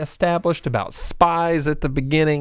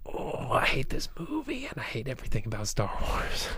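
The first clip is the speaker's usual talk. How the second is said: whispering; 12 dB quieter than usual. Real, not acted. Sudden hushed hate confession.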